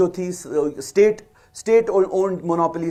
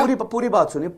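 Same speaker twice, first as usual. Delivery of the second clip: pitched up, pressing to be heard; higher insistent plea; 6 semitones higher, faster than usual. Real, not acted. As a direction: push through the interruption. Guest talks over the host.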